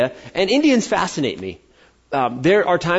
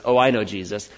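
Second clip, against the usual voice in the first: lower, offhand "Oh, I know Jesus" tossed away; dismissive; 7 semitones lower, 3 dB quieter than usual. Retold or imitated. Preacher mimicking Americans casually claiming to know Jesus, offhand and lower. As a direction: shrug it off, lower. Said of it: "you can get people that go".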